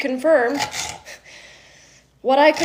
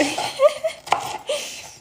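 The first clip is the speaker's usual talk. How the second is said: high airy giggle. Real, not acted. Laughs at debunkers.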